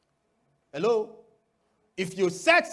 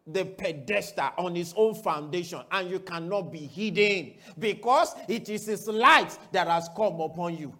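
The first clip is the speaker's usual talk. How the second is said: ordinary delivery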